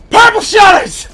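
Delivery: Purple shutters yelled high and loud; shouted punchline. Acted. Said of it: "second take shouted".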